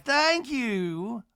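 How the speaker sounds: high, stretched put-on thanks; Miss Patricia. Miss Patricia receiving a cane, high mock-polite thanks. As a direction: draw it out high, imitating the matriarch. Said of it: "Miss Patricia's like".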